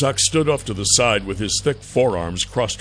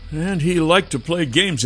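usual speaking voice

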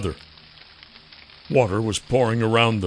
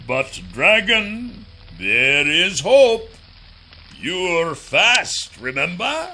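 slow, mocking, higher villain voice; sneering villain; 5 semitones higher, much slower than usual. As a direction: drawl out the taunt with contempt. Kryzak taunts.

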